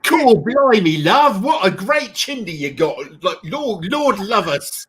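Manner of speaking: high, loud mock Cockney; bad Cockney accent. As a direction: ham up a fake Cockney chimney sweep. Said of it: "trying to do a Cockney accent".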